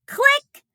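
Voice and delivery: high bright mock camera click. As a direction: pop out a tiny click.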